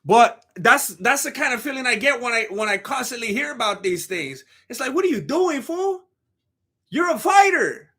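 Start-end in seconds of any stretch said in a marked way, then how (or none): 4.9-7.9 high-pitched exasperated disbelief; mock incredulous scolding. Imagined telling-off of a fighter, high and incredulous.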